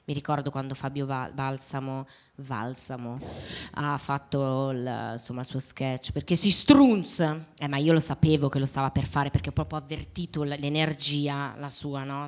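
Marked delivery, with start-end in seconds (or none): 6.1-7.2 slow, loud Neapolitan insult; Neapolitan accent. Retold or imitated. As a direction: drawl it in Neapolitan dialect. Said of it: "anche il napoletano l'hai fatto bene".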